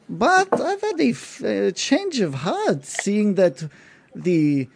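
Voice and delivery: a smug noble sparing the impostor; Gindar's voice. Acted. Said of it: "And he says".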